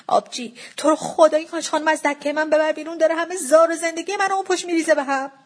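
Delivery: high-pitched, imploring complaint; pleading. A narrator's reading. Seller begging the daughter to remove her mother, pleading. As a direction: beg desperately for help. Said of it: "جوون دوباره با التماس گفت".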